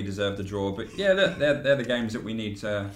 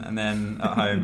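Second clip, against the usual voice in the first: usual speaking voice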